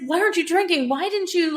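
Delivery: anguished but not mad; desperate, not angry. Retold or imitated. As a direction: beg her to answer. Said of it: "not angry as in mad".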